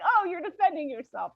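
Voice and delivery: affectionate pet talk; cooing at pet. Real, not acted. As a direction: coo at the little animal.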